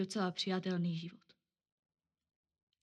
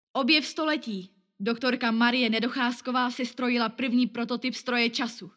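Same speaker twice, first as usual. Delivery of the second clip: headline announcement; 4 semitones higher, 8 dB louder than usual. Acted. Headline read out.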